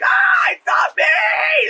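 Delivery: high, slow, drawn-out screaming denial; anguished scream. Retold or imitated. Possessed girl at the party screams in anguish. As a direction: wail it out in terror. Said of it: "the party scene where she goes".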